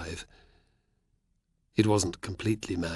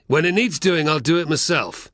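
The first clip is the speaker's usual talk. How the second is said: loud, higher angry snap; snapped; 7 semitones higher, 7 dB louder than usual. Acted. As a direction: bite the words off at the boy. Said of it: "the Apulian snapped".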